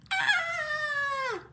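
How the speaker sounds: high-pitched, loud storybook scream. Acted. Goldilocks waking to three bears, high scream. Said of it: "she screamed".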